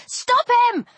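high, alarmed command; urgent yelp. A narrator's reading. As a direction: cry out for help in stopping him. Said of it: "I yelped".

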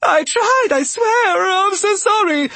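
very high, loud squealed apology; panicked cry. A narrator's reading. Rabbit begs the raging steward, panicked cry. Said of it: "Argabella cried".

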